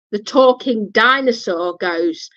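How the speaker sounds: ordinary talk, nothing marked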